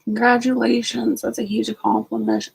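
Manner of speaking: ordinary talk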